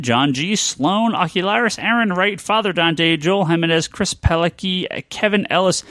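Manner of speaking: usual speaking voice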